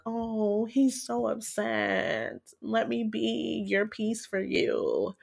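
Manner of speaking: high, slow, syrupy mock sweetness; mocking her naive self. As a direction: play the gullible girlfriend, half-mocking. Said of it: "But back then it was like".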